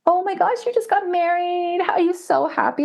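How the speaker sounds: imitating high-pitched gushing congratulations; mock-delighted, very high voice; gushing well-wishers. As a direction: squeal it like an overexcited acquaintance, drawing it out. Acts out gushing well-wishers at newlywed.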